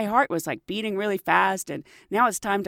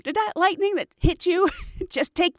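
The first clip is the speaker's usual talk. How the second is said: high teasing coworker voice; mocking coworker; 8 semitones higher than usual. Purser teasing the shaken flight attendant, high and mocking. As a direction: rib her with a high teasing voice. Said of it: "she looks at me and she goes".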